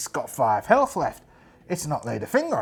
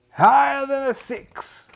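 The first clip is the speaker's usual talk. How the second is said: high, slow, loud showman call; game show host call; 12 semitones higher, 6 dB louder, slower than usual. Retold or imitated. Bruce Forsyth calling out to contestants, high and drawn out. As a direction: belt it like a TV quiz host.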